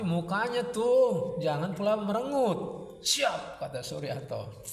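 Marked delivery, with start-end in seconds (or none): 0.0-2.6 high-pitched nagging reproach; bossy scolding. A narrator's reading. Jelitang telling sullen Suryanto off, high scolding. 3.0-3.6 high, short "yes sir" cry; obedient acknowledgement. A narrator's reading. Suryanto snapping to Jelitang's call, high ready reply.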